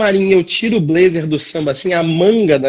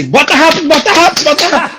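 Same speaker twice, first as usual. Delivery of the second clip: high, urgent repeated command; urgent hurrying order; 8 semitones higher, 4 dB louder, faster than usual. Retold or imitated. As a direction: hurry someone along in a panic. Retold urgent backstage order. (apressando alguém, aflito)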